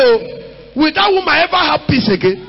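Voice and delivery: normal talking voice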